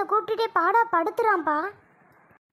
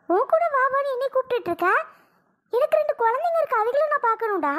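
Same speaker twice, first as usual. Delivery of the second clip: exasperated refusal; 5 semitones higher than usual. Acted. She angrily refuses to go.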